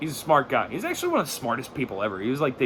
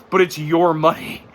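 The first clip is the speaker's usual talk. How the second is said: ordinary delivery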